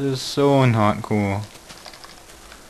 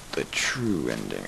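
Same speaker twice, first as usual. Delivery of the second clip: whispered, lower and slower; dramatic whisper; 10 semitones lower, 7 dB quieter, slower than usual. Shown as a demonstration. Streamer echoing his own line to viewers, slow and whispered. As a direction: breathe it like a secret omen.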